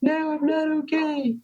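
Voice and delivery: high, soft, breaking voice; high, hushed "not okay"; retold tearful reply. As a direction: plead it in a high, soft crack. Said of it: "And I'm like".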